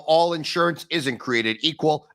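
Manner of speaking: usual speaking voice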